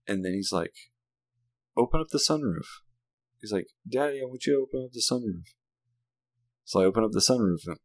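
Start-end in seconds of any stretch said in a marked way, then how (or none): 1.8-2.7 higher, imitated little-kid voice; put-on kid voice; child's request. 3.9-5.5 put-on higher, quick little-kid voice; put-on kid request, rushed; pleading child.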